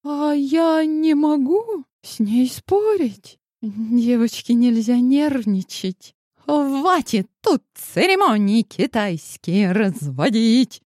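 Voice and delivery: high, sneering spite; spiteful. A narrator's reading. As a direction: spit the words out with contempt. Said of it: "Зло выплюнула Марта".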